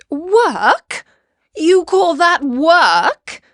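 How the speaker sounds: high, loud, incredulous mum; amused disbelief. A narrator's reading. Katie's mum mocks the cat's claim to work, incredulous. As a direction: throw it back at him, scoffing and rising. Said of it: "Katie's mum laughed".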